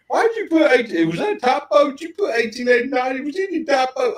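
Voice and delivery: high, loud mock-indignant voice; mimicking Felicia. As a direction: squeak it out like a puzzled friend. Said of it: "And Felicia said".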